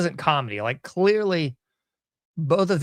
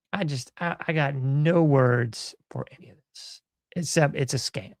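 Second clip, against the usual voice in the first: ordinary talk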